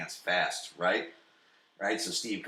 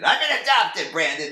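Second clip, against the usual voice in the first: higher and louder, hammed-up confession; dramatic reveal; 9 semitones higher, 9 dB louder than usual. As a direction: blurt out the big secret. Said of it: "This is the episode where Steve goes".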